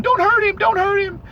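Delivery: mock high-pitched frantic plea; imitated pleading woman. Woman pleading during a fight.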